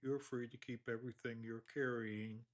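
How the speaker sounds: ordinary talk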